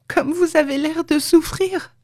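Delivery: high-pitched, distressed pity; tearful exclamation. A narrator's reading. Leonora seeing her stricken friend, tearful exclamation. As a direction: exclaim it high, as if close to tears. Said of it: "elle éclata en sanglots".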